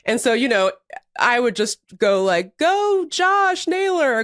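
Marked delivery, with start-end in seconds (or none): 2.6-4.2 high, stretched-out cheer for a batter; re-enacted drawn-out cheering call; fan cheer.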